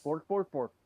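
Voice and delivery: mock chef babble; Swedish Chef bork. Players joking about accents, mock chef babble. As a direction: bork it like a silly cartoon chef.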